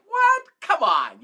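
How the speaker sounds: high, loud yelp of disbelief; mock disbelief. Coach reacting to his students' movie tip, mock disbelief. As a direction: shoot up in pitch, as if taken aback.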